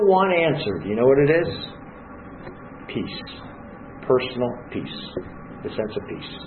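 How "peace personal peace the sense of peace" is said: hushed emphasis. Acted. Preacher answers his own question.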